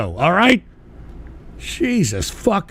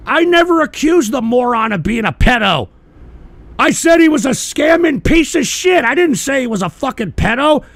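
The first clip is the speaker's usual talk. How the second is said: furious denial, yelled higher and louder; angry shout; 7 semitones higher, 5 dB louder than usual. Real, not acted. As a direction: shout him down in real anger. Denies accusation in angry rant.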